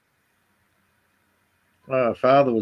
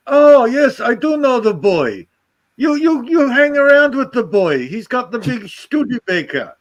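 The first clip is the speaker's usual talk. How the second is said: higher, louder imitation of his Dutch father's accent; 11 semitones higher, 4 dB louder than usual. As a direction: play the old Dutch dad, high and insistent. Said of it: "I love that accent".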